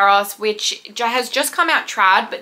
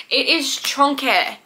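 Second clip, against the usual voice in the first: playful chonky; 3 semitones higher, slower than usual. Real, not acted. Book is huge, playful word.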